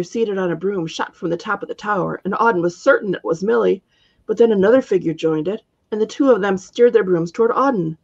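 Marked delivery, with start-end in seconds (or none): none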